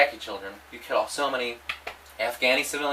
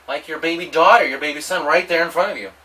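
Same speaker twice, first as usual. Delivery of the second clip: normal talking voice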